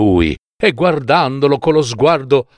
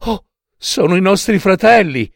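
hopeful wife; 8 semitones higher than usual. Acted. Wife hopes for rescue.

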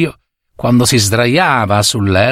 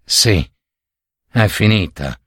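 a touch of resigned sadness; old servant sadly thinking it's all over; 4 semitones lower than usual. A narrator's reading. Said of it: "pensava il vecchio tristemente".

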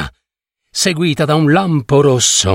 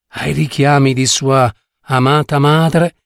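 plain reading voice

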